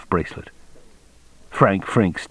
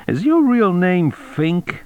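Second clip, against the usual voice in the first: slow, high-pitched probing question; interrogating cop; 11 semitones higher, slower than usual. A narrator's reading. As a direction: draw the question out high, rising on Fink. Cop interrogating Frink about his name.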